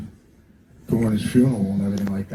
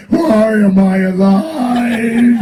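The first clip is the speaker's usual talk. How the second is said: higher, louder, slow half-sung [name] with laughter; slow sing-song [name], higher and louder; hammed-up [name]; 11 semitones higher, 5 dB louder, much slower than usual. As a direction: croon it out slowly as an exaggerated [name]. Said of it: "He goes".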